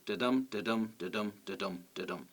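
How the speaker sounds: da-dum, da-dum with the weight on each dum; iambic da-dum beat. Shown as a demonstration. Teacher chanting da-dum to show the beat. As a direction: tap out the iambic rhythm, light then heavy. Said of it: "each one an unstressed followed by a stressed syllable".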